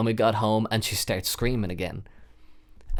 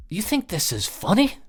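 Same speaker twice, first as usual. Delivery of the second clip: higher, incredulous challenge; indignant question; 10 semitones higher than usual. Retold or imitated. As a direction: call her out for joking. Angry at her joke.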